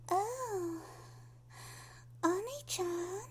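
put-on tiny, sweet high-pitched girl voice; little voice. Shown as a demonstration. Showing listeners her little voice, high childlike squeak. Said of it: "you get the little voice".

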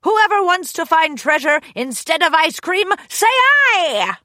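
high, loud rousing call; rally the crew to shout back. Acted. Captain rallies her crew.

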